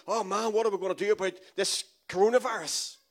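hammed-up worried committee voice; mock panicked God. Preacher mocking a panicked heavenly meeting, hammed-up. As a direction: play God fretting over the virus. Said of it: "Holy Ghost saying".

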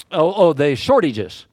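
usual speaking voice

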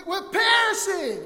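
slow, high, drawn-out yell; acted desperate cry through a storm; shouting over wind. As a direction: stretch it out at full shout. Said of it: "the wind is so loud they can't hear him".

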